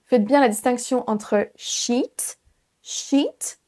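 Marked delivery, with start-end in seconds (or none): 1.5-3.6 "sheet" with a long drawn-out EE, rising. Shown as a demonstration. Teacher showing the distinction, long EE in "sheet". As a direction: stretch the vowel in "sheet". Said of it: "là c'est un I long".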